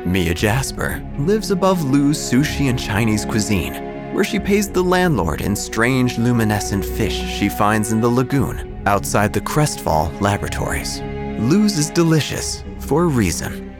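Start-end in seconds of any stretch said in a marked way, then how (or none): none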